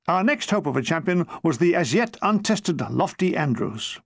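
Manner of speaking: ordinary delivery, nothing marked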